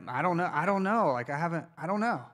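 mock-helpless protest, pitch jumping up; retold flustered uncertainty. Preacher retelling his answer to the team, flustered.

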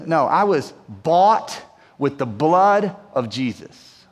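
ordinary delivery

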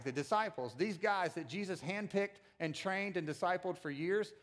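ordinary delivery

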